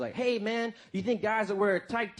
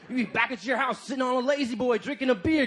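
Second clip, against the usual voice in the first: ordinary delivery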